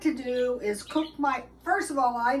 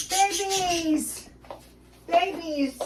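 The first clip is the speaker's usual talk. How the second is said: high, sing-song call to her pet birds; 3 semitones higher, much slower than usual. Real, not acted. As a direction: coax the birds with a lifted call.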